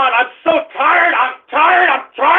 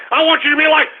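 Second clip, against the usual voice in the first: normal talking voice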